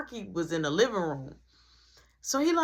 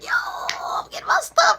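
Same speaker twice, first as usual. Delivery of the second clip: very high, loud gleeful squeal; excited squeal; 7 dB louder than usual. Retold or imitated. Brother squeals to Marky about getting his stuff, gleeful. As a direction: burst out with glee.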